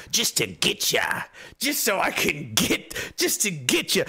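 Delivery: slow, drawn-out sarcastic taunt; sarcastic mockery. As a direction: stretch each word out, mocking the accusation. Sarcastic mock of accusers' claim.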